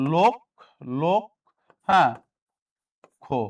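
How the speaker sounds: লোক said alone, pause, then খো; syllable demo. Shown as a demonstration. Teacher building the pronunciation for students, separated syllables. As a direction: break it into লোক and খো with long pauses. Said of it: "লটা কি হবে ভাইয়া?".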